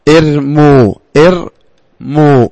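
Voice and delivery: ارموا broken into two slow syllables, "ir... mu"; damma on the mim. Shown as a demonstration. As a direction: draw out ارموا to show the u after m. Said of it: "الميم مضمومة ولا ما هي مضمومة؟".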